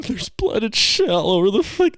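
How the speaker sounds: high, loud mock-crying wail; tearful. Reporting the bloody bathroom to the back room, tearful wail. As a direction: blubber it out at a very high pitch, hammed up. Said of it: "with tears in my eyes".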